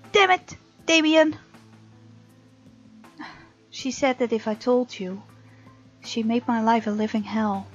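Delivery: ordinary delivery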